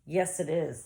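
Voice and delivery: plain reading voice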